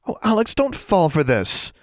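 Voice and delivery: high-pitched anxious plea; worried. A narrator's reading. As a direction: warn a friend anxiously. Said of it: "Anna said worriedly".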